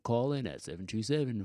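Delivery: smooth radio announcer read. Acted. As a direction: read the number like a DJ. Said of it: "That's that radio voice".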